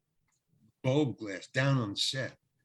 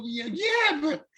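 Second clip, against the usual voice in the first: high-pitched, louder mock protest; retold protest; 7 semitones higher, 4 dB louder than usual. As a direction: protest in a raised, incredulous pitch. Retold baffled protest over donuts.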